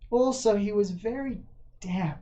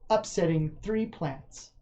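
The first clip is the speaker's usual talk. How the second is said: usual speaking voice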